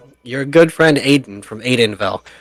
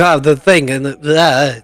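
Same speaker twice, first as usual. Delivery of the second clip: flustered mock stammer, a bit raised; 3 semitones higher, 4 dB louder, much slower than usual. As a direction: fumble for the words in character. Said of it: "he's, like, mostly stammering".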